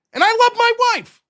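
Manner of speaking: high, loud insistence on loving his wife; loud emphatic aside. Real, not acted. Joking husband insisting he loves his wife, loud. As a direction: blurt it out to cover himself.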